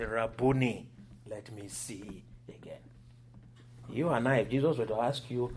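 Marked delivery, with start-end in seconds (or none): 0.0-2.8 hushed, fading to a whisper; quiet retold plea. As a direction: plead softly and low, trailing into a whisper. First quote of blind man's plea.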